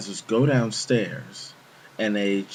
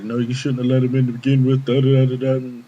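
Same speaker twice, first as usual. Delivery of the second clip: fast, louder mocking nag running into da-da-da; mock scolding supervisor; 3 semitones higher, 7 dB louder than usual. Supervisor telling off desk worker, mock scolding. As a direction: imitate a boss's rant, rattled off loudly.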